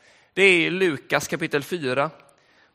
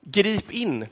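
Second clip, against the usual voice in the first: normal talking voice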